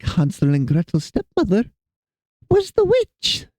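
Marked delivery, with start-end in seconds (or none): 2.5-3.5 very high, slow, silly cackly voice; silly witch voice. Shown as a demonstration. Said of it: "I don't know where that voice came from".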